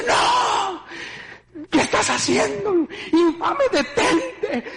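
high, desperate shouting at the attacker; terrified shouting. Retold or imitated. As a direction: scream at him to stop, terrified. Reliving seeing the blood. (gritos aterrados y agudos)

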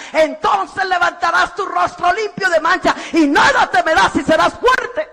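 building from plain to a loud, ringing proclamation; scripture proclaimed. Acted. Preacher reading a Bible promise to parents, building to loud. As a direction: swell the verse into a triumphant declaration.

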